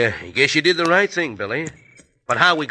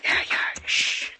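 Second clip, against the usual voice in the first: whispering; 6 dB quieter, slower than usual. Acted. Sneaking near jail at night.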